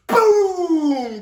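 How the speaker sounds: a sudden booming boom sound; mock boom. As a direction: voice the transformer exploding. Transformer blowing.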